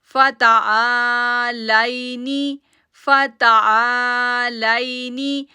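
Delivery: "फतालाइनी" very slow, "ता" held two seconds; long aa. Shown as a demonstration. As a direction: hold the "ता" two counts. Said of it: "आ को हम खींचेंगे दो second". ("आ" को दो सेकंड खींचो)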